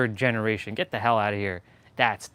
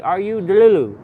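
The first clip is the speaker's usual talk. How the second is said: high, loud imitation Jamaican accent; Jamaican or African accent; 6 semitones higher, 7 dB louder than usual. TikTok guy questioning girls, imitated Jamaican accent. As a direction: put on a Jamaican man's accent, pitched up. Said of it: "looking at girls and be like".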